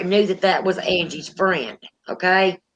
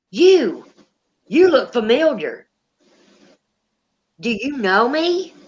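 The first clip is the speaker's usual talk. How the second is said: a hint of a woman's spirit voice; female voice; 3 semitones higher than usual. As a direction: lightly imitate the female voice speaking through him. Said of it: "it's a female voice coming out of him".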